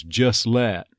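"Just let" as "juss-let"; dropped T. Shown as a demonstration. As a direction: join "Just let" with no T between. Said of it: "the T might go away".

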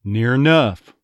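near enough in an East Texas voice; Texas accent. Shown as a demonstration. An accent coach demoing near enough in Texas. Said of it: "drop that first syllable".

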